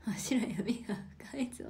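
hushed ominous whisper; reading a viewer's spooky comment, whispered. Retold or imitated. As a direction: whisper it darkly like a secret. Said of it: "って".

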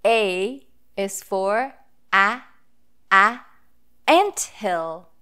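slow a, a, "anthill" said clearly; sounding out A. Shown as a demonstration. Teacher sounding out "anthill" for the reading circle. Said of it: "the sounds that the vowel letter A makes".

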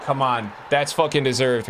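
ordinary talk, nothing marked

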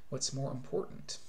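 ordinary talk

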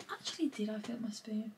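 unvoiced muttered aside; whispering to herself. Real, not acted. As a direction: whisper it to yourself while busy. Muttering to herself while making matcha.

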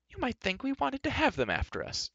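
put-on high-pitched, indignant girl's voice; high, impatient outburst; impatient cry. A narrator's reading. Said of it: "cried Elenwy".

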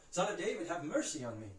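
high, loud insistent plea. A narrator's reading. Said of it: "he cried out all the more".

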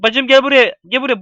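normal talking voice

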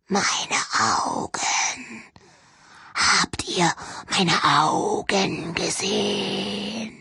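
slow, crying, breathless ghost whisper; ghostly whisper. Acted. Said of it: "flüsternd durch Sandpapier raue Lippen".

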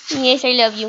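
ordinary talk, nothing marked

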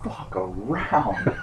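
slow words into laughter; relieved laugh. Real, not acted. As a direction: laugh off the tension. Relieved laugh after survival.

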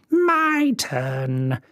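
a touch of crone voice; fairy steps up to the cot; old fairy Griselda. Acted. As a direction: claim the moment with relish. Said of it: "said Griselda".

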